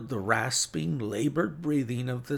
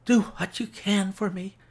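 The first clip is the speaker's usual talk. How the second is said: high, strained invalid's plea; feeble pleading; 11 semitones higher, 4 dB louder than usual. A narrator's reading. Sick Holmes begging his visitor, high feeble plea. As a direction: beg weakly in a thin raised pitch. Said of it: "he whispered".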